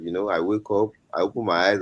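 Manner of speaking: usual speaking voice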